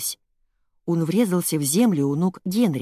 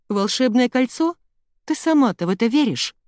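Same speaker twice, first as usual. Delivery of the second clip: high, louder scoffing question; incredulous; 7 semitones higher, 5 dB louder than usual. A narrator's reading. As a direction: question it in disbelief. Mother dismisses sister's magic story. (недоверчиво, с возмущением)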